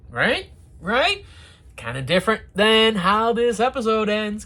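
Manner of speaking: ordinary delivery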